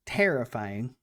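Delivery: ordinary talk, nothing marked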